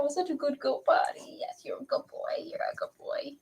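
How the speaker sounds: soft unvoiced whisper. Real, not acted. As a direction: hush it to the puppy. Whispering praise to puppy.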